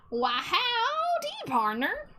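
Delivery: hammed-up Southern cowboy drawl, high on howdy; Southern cowboy accent. Streamer greets chat in a mock Southern cowboy accent. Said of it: "I gotta talk like this".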